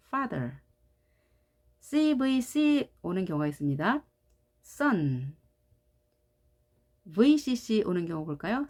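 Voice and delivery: ordinary talk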